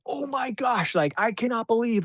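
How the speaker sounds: high-pitched, amazed gushing; over-the-top confidence. Retold or imitated. Client back after redoing his videos, gushing confidently. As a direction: gush it high, imitating a thrilled client. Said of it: "just his confidence was over the top".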